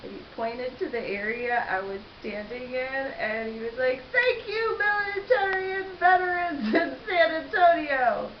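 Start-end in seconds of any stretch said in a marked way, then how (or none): 4.1-8.3 high-pitched, louder imitation of a gusher; over-the-top high thank-you; mock gushing thanks. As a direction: gush it in a high put-on voice. Retold gushing thanks to veterans.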